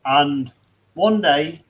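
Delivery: ordinary talk, nothing marked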